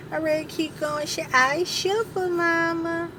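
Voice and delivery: put-on high cooing baby talk; high-pitched baby talk to Duke; baby talk to dog.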